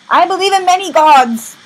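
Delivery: high, loud hammed-up declaration; over-the-top exclaimed creed; mock proclamation. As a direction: proclaim it grandly to the crowd. Dignitary proclaims faith, acted.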